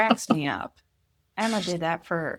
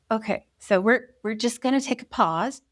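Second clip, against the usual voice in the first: higher, louder, forced-patience mum voice; strained parental patience; 4 semitones higher, 5 dB louder than usual. Retold or imitated. Mother calling a pause with her arguing daughter, strained patience. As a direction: act out a parent reining in exasperation. Retelling exasperated talk with daughter.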